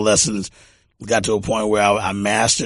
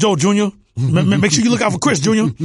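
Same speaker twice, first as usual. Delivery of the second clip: high, loud call to Junior; raised, high-pitched dad impression; imitating his dad; 11 semitones higher, 3 dB louder, faster than usual. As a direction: mimic a dad hollering for his son, pitched well up. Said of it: "that's how he said it".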